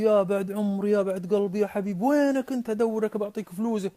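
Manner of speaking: high-pitched, effusive endearments; fawning commander. Acted. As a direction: gush over him like a long-lost darling. Commander suddenly fawns over the merchant. (بتودد مبالغ فيه وصوت عالي النبرة)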